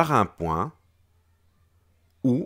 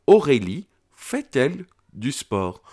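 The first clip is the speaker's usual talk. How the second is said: "Aurélie fait-elle du sport?" higher and louder, stress on "Aurélie"; questioning intonation; 5 semitones higher, 5 dB louder than usual. Shown as a demonstration. Teacher modelling the full question for the pupil, louder. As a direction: put weight on "Aurélie", then lift the question. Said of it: "je change également l'intonation de ma voix".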